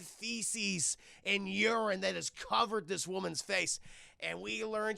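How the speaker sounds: normal talking voice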